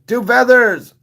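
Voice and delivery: loud high greeting shout; excited loud call to Two Feathers. Real, not acted. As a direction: hail a friend across the room. Calling out to a friend.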